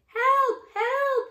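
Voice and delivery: high, loud panicked call; frightened cry for help. Acted. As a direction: scream for help in fear. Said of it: "called a frightened voice".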